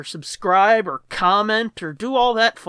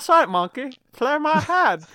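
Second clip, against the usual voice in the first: silly voice; 7 semitones higher than usual. Pretend. Goofy sign-off bit.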